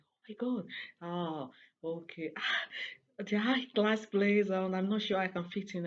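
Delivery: high, loud mock awe, slowing on the high class place; imitating awed friend. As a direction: play her up as dazzled and intimidated. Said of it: "she was like".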